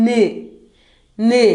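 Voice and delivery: "ന്" as a short, isolated N sound; letter sound of N. Shown as a demonstration. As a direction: say "ന്" as the bare phonics sound, not the letter name. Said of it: "എന്നിന്റെ sound".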